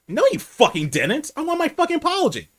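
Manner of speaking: high-pitched, heated retort; angry demand. Retold or imitated. Customer demanding an apology from the manager, angry. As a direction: insist on the apology, voice shooting up. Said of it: "glaring at him".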